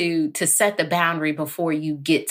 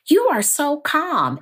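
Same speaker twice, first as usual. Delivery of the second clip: high, lilting imitation of others; lightly voiced admirer, pitched up; playful high "so calm" voice; mock admiring; 11 semitones higher than usual. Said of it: "I get a lot from people".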